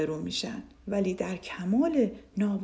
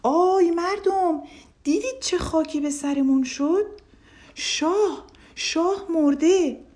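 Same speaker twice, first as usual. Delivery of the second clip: a shout to the whole street, high and slow; old man raising the alarm loudly; loud cry; 8 semitones higher, 6 dB louder, slower than usual. A narrator's reading. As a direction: call out to the crowd, drawn out. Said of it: "با صدای بلند فریاد زد".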